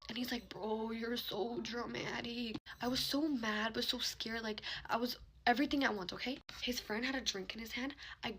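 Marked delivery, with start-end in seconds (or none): 0.5-2.6 slow, soft mimicked complaint; mock dismissive retort. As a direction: imitate him, dragging the words out softly. Guy's first retort, retold.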